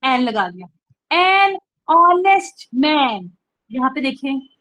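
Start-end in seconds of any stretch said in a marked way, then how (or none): 1.1-3.3 An honest man, very slow, silent h in honest; slow example read. Shown as a demonstration. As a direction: say honest as onest, slowly. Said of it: "एच है". (An honest man धीरे, एच silent)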